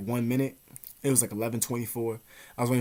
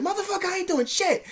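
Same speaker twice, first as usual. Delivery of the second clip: much higher put-on ad voice; commercial catchphrase. Every line he says sounds like a commercial, high ad voice. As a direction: sell it like a TV catchphrase. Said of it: "it's like a catchphrase".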